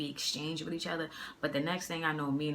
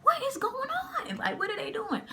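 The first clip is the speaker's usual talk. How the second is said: high-pitched, loud mock bewilderment; imitated shocked disbelief; baffled onlookers; 4 dB louder than usual. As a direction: voice the gawking bystanders, way up high.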